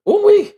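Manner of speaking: very high loud ooh-wee to release emotion; ooh-wee outburst. Real, not acted. Collecting herself after crying on stream, loud release. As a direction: let the feeling burst out high. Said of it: "I'm together".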